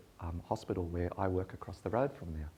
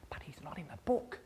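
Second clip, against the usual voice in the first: hushed, higher whisper; whispered objection; 6 semitones higher, 3 dB quieter than usual. Retold or imitated. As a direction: mutter it under your breath. Admin person objecting.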